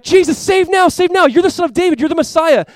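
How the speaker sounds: high, loud excited shout; crowd crying out. Retold or imitated. Crowd lining the streets hailing Jesus, high, loud cry. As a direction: call out to Jesus like the parade crowd. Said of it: "And so they're crying out".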